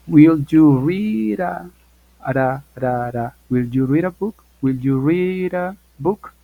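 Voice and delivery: "read" ending in a tapped R linked into "a", slow; linked flap R. Shown as a demonstration. Teacher demonstrating to students the American linking. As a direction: run "read a" together as "rira". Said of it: "convertir a la D en una R".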